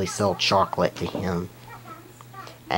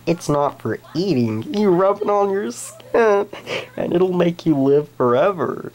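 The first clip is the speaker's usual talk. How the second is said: higher, louder excited sales pitch; salesman pitch; 9 semitones higher, 4 dB louder than usual. Retold or imitated. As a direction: hype it up like a miracle product. Salesmen pitching to old woman.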